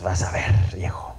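whispered aside to the husband; whispering to her husband; whispered promise. Acted. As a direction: breathe it out as a secret promise.

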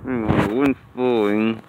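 talking around a cigar; cigar in mouth. Real, not acted. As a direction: lips clamped on cigar. Seen 1: Cigar held between lips the whole time he speaks.

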